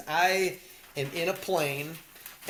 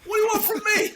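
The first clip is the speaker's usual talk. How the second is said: high, loud tough guy; hammed-up loud gangster; mock Tommy; 14 semitones higher, 6 dB louder than usual. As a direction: shout it proudly. Quoting Tommy after the killing.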